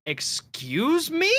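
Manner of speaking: drawn-out, high rising question; affronted challenge. Retold or imitated. Homeowner finding a stranger at the back door, affronted. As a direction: imitate an offended homeowner, stretching it out slowly. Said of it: "and were like".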